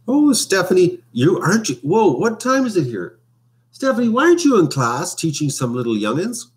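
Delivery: high, playful cry of surprise; mock surprise. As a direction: tease her with high-pitched, exaggerated astonishment. Spots viewer who should be teaching.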